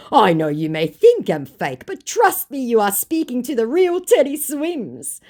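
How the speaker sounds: high, loud, over-earnest impostor; mock scammer. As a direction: plead mockingly as the fake star. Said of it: "said my fake".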